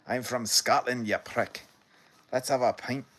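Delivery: put-on loud stab at a Scottish accent; attempted Scottish accent. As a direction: put on a half-hearted Scottish accent, punched out. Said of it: "I can't do the full accent".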